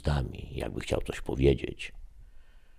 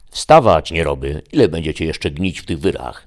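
thundering father; 2 semitones higher, 9 dB louder than usual. Acted. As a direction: bellow the lazy sons out of bed. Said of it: "grzmiał Ronan".

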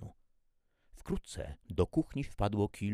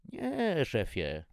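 high-pitched, louder first word; nervous trainee; 8 semitones higher, 5 dB louder, much slower than usual. A narrator's reading. Trainee answering the pressing chef, high nervous reply. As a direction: start high, then drop back.

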